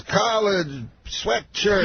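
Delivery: very slow, high, word-by-word impression; Bill Cosby. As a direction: let each word stand alone, drawn right out. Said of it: "like, just in words".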